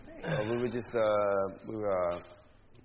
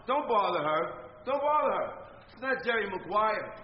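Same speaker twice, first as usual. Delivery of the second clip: high, loud, furious yelling; angry shouting; 9 semitones higher, 4 dB louder than usual. Acted. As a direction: scream at him to stop. Boss shouting during confrontation.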